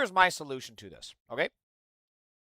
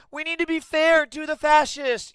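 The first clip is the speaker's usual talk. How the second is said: high, slow sneering mock voice; mocking liberals; 13 semitones higher, 7 dB louder, slower than usual. Streamer mocking liberals, high and sneering. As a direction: parody their plea, whining. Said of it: "these liberals are like".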